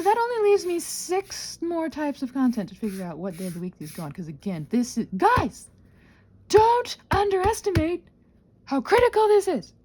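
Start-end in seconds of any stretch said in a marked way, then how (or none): none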